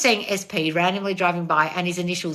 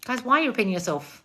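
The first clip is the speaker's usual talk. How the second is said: usual speaking voice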